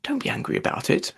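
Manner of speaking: calm the mouse gently; soothing. A narrator's reading. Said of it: "in a soothing tone".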